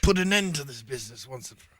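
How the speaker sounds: lightly voiced Sherlock Holmes, English accent. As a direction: improvise as Sherlock in English accent, fast at the end. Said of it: "turn to Watson and say".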